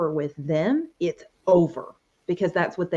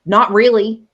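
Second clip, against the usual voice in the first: blunt reply; 4 semitones higher, 11 dB louder than usual. Retold or imitated. Husband's reply retold.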